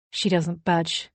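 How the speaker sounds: usual speaking voice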